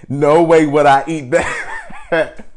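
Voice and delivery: ordinary talk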